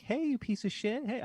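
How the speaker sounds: high, drawn-out coo to a dog; playful pet-talk. Acted. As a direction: greet a beloved dog in baby talk. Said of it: "it's all how you act toward the animal".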